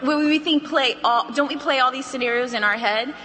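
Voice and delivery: normal talking voice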